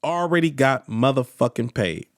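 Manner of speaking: low-pitched, settled restatement of the boast; low deadpan repeat. Acted. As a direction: drop it low and matter-of-fact. Last repeat, dropped down for effect.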